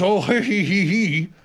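imitated tittering he-he-he; put-on giggle; mock laughter. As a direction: mimic everybody laughing along. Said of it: "everybody goes".

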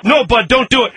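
high, urgent plea; alarmed pleading. Acted. Host begs sidekick to spare kittens, alarmed. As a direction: stop him before it's too late.

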